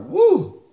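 sudden high-pitched woo at the sourness; high sour whoop. Real, not acted. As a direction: yelp high as the sour hits. Reacting to sour candy.

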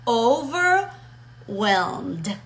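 "overwhelmed" very drawn out, split "over... whelmed"; patient slow-motion model of "overwhelmed"; slow, in syllables. Shown as a demonstration. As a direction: stretch "overwhelmed" with a gap in the middle. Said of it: "o pártanlo en sílabas".

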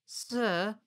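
put-on very high child voice; high-pitched timid address; anxious small boy. A narrator's reading. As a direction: squeak it up at the officer. Boy addresses frightening officer.

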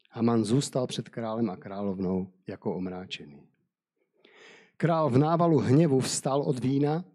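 steady storyteller's reading voice; measured Bible story narration. Acted. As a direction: read the tale aloud to listeners. Reads Esther chapter seven.

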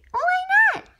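put-on high, whiny kid frog; little frog child. Son frog whines to Daddy in a high child voice. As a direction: pipe up like a small frog child.

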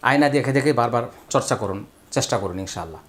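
ordinary talk, nothing marked

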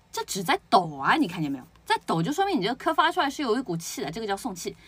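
ordinary talk

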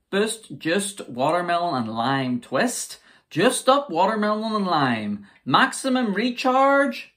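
high, loud, slow label hype; announcer voice. Pretend. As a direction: read the can like an advert. Reads the can label.

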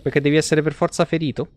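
ordinary talk

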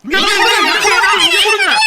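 loud, high-pitched demand; clamouring shout. Acted. Hungry crowd pushing for food, clamouring loudly. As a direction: shout over a jostling crowd.

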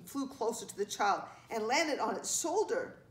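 ordinary delivery, nothing marked

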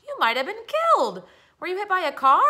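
high, loud alarmed cry; panicked worried parent; frightened mother. A narrator's reading.

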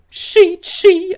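high, loud little steam whistle; cheerful locomotive chuff; engine puff. A narrator's reading. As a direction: toot it like a tiny engine. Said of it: "antwortete Thomas".

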